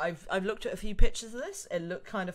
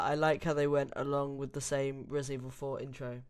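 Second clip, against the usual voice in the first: ordinary delivery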